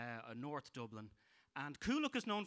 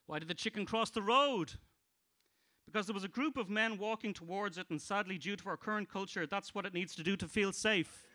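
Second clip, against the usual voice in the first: loud, higher punchline voice; stand-up joke telling; 5 semitones higher, 4 dB louder than usual. Acted. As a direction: sell each gag to the crowd. Comic telling jokes.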